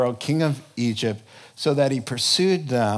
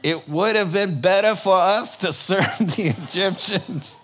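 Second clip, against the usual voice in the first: put-on Yiddish accent; 5 semitones higher, 5 dB louder than usual. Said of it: "I sounded so much like my dad".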